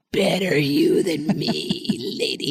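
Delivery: put-on slow, drawn-out old hag; a lightly voiced crone muttering at a young mother. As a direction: croak it out like the hag who comes out of the fog. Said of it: "I'm like".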